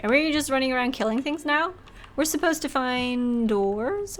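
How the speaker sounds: ordinary delivery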